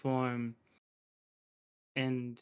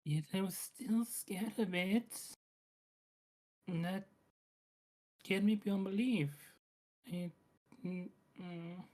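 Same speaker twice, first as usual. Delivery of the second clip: whispering; 5 semitones higher than usual. Acted. Student whispering confession.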